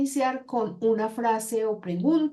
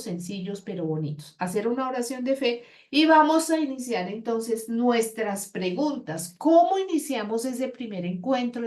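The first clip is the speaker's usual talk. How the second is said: usual speaking voice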